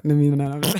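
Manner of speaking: ordinary talk, nothing marked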